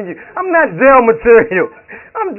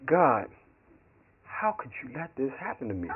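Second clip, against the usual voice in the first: much softer, lower, almost under the breath; quiet prayer; 11 semitones lower, 16 dB quieter than usual. Retold or imitated. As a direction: murmur the plea to God quietly. Praying in jail cell.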